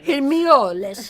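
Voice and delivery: mock Italian accent. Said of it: "Oh, that's Italian".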